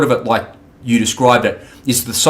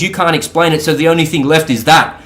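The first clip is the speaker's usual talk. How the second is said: ordinary talk, nothing marked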